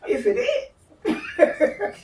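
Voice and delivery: high, laughing plea to God, slowing down; laughing mock prayer. Asking God if the Marine is the one, laughing high plea. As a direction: pray through giggles, hoping it's true.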